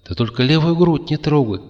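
высоковатый голосок зверька; попроси тонким голоском зверька; пушистый зверёк. A narrator's reading. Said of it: "ответил голос пушистого зверька". (голос пушистого зверька)

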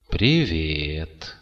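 тягучее женское приветствие; растянуть слово, не торопясь; медленно. A narrator's reading. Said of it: "медленно произнесла девушка". (медленное протяжное приветствие)

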